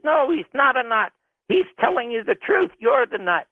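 high-pitched indignant retort, not shouted; retold screaming argument. Host defending Garrison to the owner, retold heated protest. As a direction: argue back heatedly, pitch jumping up. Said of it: "I start screaming at him".

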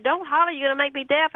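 high-pitched mock holler; retold holler. Collector complains down the phone, high mock holler. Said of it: "But then he hollered".

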